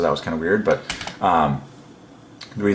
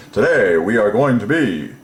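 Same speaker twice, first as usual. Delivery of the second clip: loud, higher-pitched showy announcement; hammed-up class entertainer; 4 semitones higher, 5 dB louder than usual. Shown as a demonstration. Teacher opening a class to entertain, loud hammed-up announcement. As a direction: launch the lesson like a showman.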